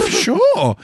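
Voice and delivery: high-pitched, drawn-out imitated reply; coy eager acceptance. Retelling own answer to the Brazilian man, coy acceptance. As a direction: let it swoop up high, playfully willing.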